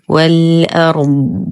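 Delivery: slow والأرض ending on a thick, pressed, lengthened ض; heavy prolonged daad. Shown as a demonstration. Teacher shows stopping on the daad, heavy and prolonged. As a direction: stop on the ض of والأرض with full heaviness. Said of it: "نقف على الضاد بالاستطالة والتفخيم والإطباق".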